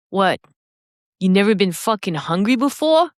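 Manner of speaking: swaggering Boston teacher impression; incredulous challenge in a Boston accent. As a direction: put on a blunt Boston accent. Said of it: "swagger in his Boston accent".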